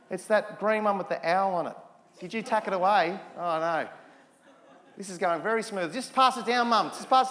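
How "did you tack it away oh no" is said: ordinary delivery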